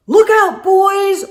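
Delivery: high loud warning; captain warns his crew; shouted order. Acted. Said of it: "ordered the captain".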